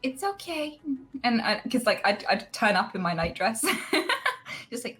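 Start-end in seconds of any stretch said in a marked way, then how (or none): 0.0-0.8 soothing, much higher, like coaxing a sleepwalker; lightly voiced kindly shop owner easing her home; gentle newsagent voice. Newsagent ushering sleepwalking girl home, gentle higher voice. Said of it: "and be like".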